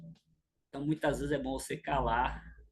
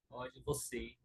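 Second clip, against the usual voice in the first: quiet, slow, frowning "pode você fazer"; frowning request; 4 semitones lower, 5 dB quieter, slower than usual. Retold or imitated. Wife asking her husband with a slow frowning voice. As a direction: ask it with a stern frown. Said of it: "franzindo assim a testa".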